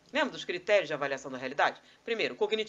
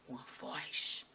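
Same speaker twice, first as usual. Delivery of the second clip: hushed intensity; 6 semitones lower, 8 dB quieter, much slower than usual. Acted. Mocks imposing oneself by voice.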